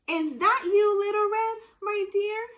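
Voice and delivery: put-on high, loud old granny call. Grandma calling out to the wolf at her door. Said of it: "called Grandma".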